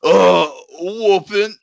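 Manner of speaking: mock slow-witted parroting; dim echo. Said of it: "he just says the last thing that you said".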